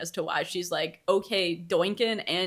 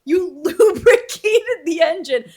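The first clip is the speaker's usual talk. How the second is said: higher and louder, slow, breaking into laughter; laughing mock awe; 7 semitones higher, 10 dB louder, much slower than usual. Heroine praising the monster's engine work, laughing mock awe. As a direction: gush it while cracking up.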